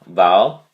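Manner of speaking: "val" said alone, loud and punched; stressed syllable. Shown as a demonstration. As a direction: hit "val" hard, as a lone syllable. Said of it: "the emphasis on the first syllable".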